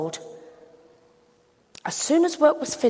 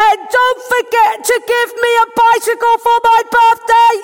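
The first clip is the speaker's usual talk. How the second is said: put-on high, loud child's shout; little boy shouting his birthday wish at God; 12 semitones higher, 9 dB louder than usual. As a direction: yell it up to heaven like a small boy. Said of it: "he shouted".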